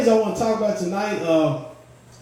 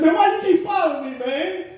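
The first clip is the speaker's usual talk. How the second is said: high, indignant yell at the driver; annoyed runner yelling at the ambulance driver; mad; 10 semitones higher than usual. Retold or imitated. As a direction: demand it, exasperated and a bit higher. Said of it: "I got mad. I said".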